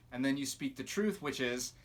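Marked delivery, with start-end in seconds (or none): none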